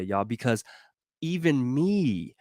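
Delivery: normal talking voice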